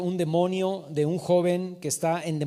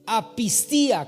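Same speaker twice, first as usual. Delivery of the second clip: Apistía with the stressed, lengthened "í"; Apistía, drawn out with the accent on í; 2 semitones higher, slower than usual. Shown as a demonstration. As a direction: say Apistía slowly, leaning on the final "í-a". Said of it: "con acento en la I".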